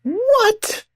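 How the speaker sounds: real disbelief leaping way up; stunned what. Real, not acted. Listener hearing the guru's reply, shocked high squeak.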